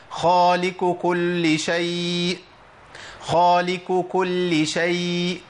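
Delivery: slow chant, خالق ending "khaaliku" with a k; drawn-out recitation, light k for the q in خالق; qaf turned kaf. Shown as a demonstration. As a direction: mispronounce خالق with kaf, merging into كل. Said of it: "أنا ممكن أبدل القاف بكاف،".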